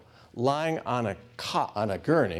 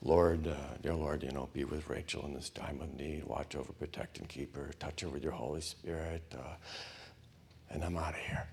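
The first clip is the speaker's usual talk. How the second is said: much lower, softer, rote mumbled prayer; faithless prayer; 9 semitones lower, 10 dB quieter than usual. Retold or imitated. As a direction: pray just to be done with it. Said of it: "some kind of faithless prayer".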